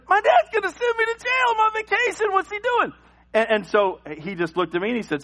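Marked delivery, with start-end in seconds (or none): 0.1-2.9 put-on high, frantic child voice; a scared boy sure he's headed to jail; much higher mock-panicked kid.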